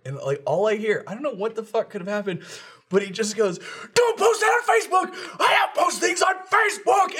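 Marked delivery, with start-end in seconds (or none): 3.9-7.2 very high-pitched, full-force furious shout; enraged screaming. Retold or imitated. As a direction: imitate a man screaming rage into his phone. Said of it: "just screaming into his phone".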